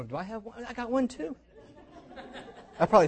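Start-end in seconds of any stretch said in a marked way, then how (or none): 0.0-0.6 hushed worried question. Acted. Disciple checks for flame. 0.6-1.4 higher, laughing joke; a laughing, playful boast. Real, not acted. Joking about own flame.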